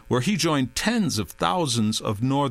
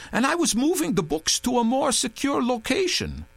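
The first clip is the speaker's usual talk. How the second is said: insistent; 13 semitones higher than usual. A narrator's reading. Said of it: "Touré insisted".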